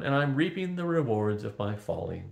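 ordinary delivery, nothing marked

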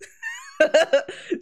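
high loud cackle of laughter; laughing hard at the chaos; real burst of laughter. Real, not acted. Varushka's antics crack her up.

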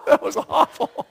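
words through a high laugh. Real, not acted. Preacher after an unintended joke, laughing. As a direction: laugh it off, embarrassed. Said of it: "that was a bad joke".

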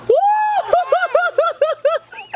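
high, joyful whoop and laugh. Real, not acted. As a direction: laugh while sliding. Sliding down the slope.

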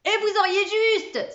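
excited cry. A narrator's reading. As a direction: shout it out with delight. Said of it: "s'écria le grand Lantier".

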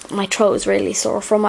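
ordinary talk

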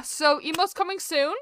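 usual speaking voice

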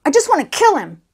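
high, loud seething outburst; acted muttered threat, hardly hushed; angry muttering. A narrator's reading. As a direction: seethe it out in a temper. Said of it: "he muttered".

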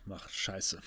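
ordinary talk

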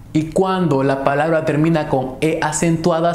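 ordinary talk, nothing marked

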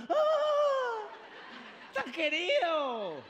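high, slow gushing squeal; swooning mother. Retold or imitated. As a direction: gush like a smitten mom.

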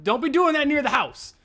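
high, urgent warning; urgent scolding. Real, not acted. Gamer warning co-player off the house, urgent scolding. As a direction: scold it quickly, pitched well up.

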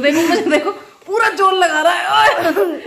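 high, loud, giggling through the words; laughing excitedly. Real, not acted. Mother laughs as her pet struggles with the box. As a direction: laugh along as he strains.

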